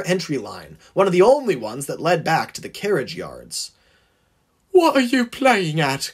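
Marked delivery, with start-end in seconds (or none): 4.7-6.1 high, indignant coach voice; calling out. A narrator's reading. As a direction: call out a high-pitched complaint. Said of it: "called a very groggy coach".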